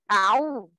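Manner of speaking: أعو said with a glottal stop instead of the throat ع; wrong ain. Shown as a demonstration. Teacher demonstrates the wrong ain to trainee teachers. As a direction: show the mistake: hamza then عو. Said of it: "ما ينفعش آجي عين مضمومة أقول أعو".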